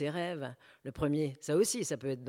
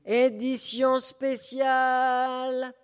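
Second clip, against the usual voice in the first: high, loud, slowly drawn-out street cry; newspaper crier; 9 semitones higher, 8 dB louder, much slower than usual. Acted. Newspaper seller crying out to passers-by, long and loud. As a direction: hawk the special edition to the whole street. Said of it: "Un crieur de journaux".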